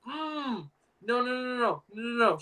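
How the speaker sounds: soft, high run of no's; retold squeamish protest; mock horrified whimper. Acted recoil from Nana.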